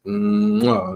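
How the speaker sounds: smacking kiss sound; blown kiss. Real, not acted. Man counting down days to his trip, smacking kiss. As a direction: plant a big audible kiss. Can't wait to go back.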